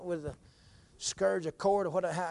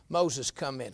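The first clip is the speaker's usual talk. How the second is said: usual speaking voice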